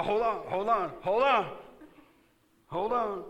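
high, loud call to halt; rising urgency, hold on shouted. Acted. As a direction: cut in loudly to stop everyone. Preacher stops the reading.

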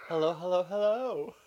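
high, loud drag queen hellos; sing-song camp greeting, rising; [name]. Retold or imitated. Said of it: "Uh, [name]".

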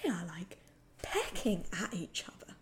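hushed, breathless whisper of disgust. Real, not acted. Host whispering in disgust about the couple kissing. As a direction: whisper it like it's too gross to say aloud.

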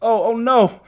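high, frightened plea; begging. A narrator's reading. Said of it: "begged Asa".

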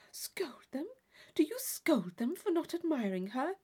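can't believe she scolds men; surprised Catherine. Acted.

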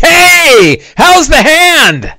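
jolly dwarf. Acted. Dwarves greet the hero at the inn, jolly high voice. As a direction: hail a friend in a high, drawn-out cry.

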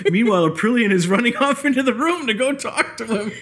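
high, amused telling through laughter; laughing. Real, not acted. Telling of guildmate running into the room, laughing. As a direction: barely hold in the laugh as you tell it. Laughing as she tells the mishap.

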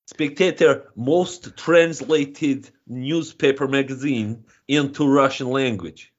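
hammed-up Russian accent; broad comic Russian accent; mock Russian accent. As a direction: play it as a proud Russian comedian in a thick Russian accent. Comic Russian guest persona.

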